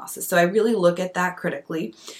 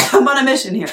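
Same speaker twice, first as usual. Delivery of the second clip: playful; 7 semitones higher, 8 dB louder, faster than usual. Real, not acted. Joking after a laugh.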